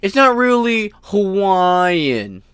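high, slow sneering mock voice; snide mock of the court; mocking imitation. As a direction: ham up a smug judge. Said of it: "flagrantly say".

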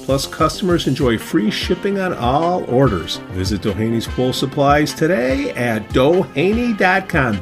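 upbeat sponsor read over music. Acted. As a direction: sell it like a radio spot.

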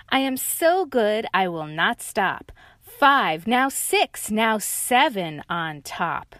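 loud smug boast; loud cocky tiger. Acted. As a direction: brag like a show-off. Tiger brags.

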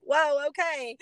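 high mock-startled whoa; recalling her water breaking in the tub, surprised. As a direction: retell the moment the water broke, caught off guard. Water breaks suddenly in bathtub.

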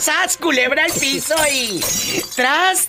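high, slow, ringing call; catchphrase cry. Acted. Host cheering on the caller with her signature cry, high and slow. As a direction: belt it out like a radio hype shout.

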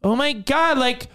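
high, gushing; hammed-up breathless girl; mock girl voice. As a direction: squeal it like a starstruck fan. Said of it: "some girl comes up and she's like".

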